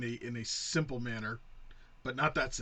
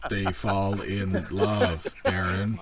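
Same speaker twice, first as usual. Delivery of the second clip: very slow, louder pointed repeat; very slow, louder sarcastic concession to Aaron; 4 semitones lower, 5 dB louder, much slower than usual. Real, not acted. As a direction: drag each word out at Aaron.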